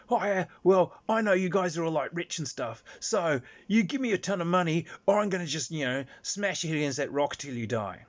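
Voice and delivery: high, loud mock thug; hammed-up shakedown of a tiny fairy; bullying threat. Player threatening the captured fairy.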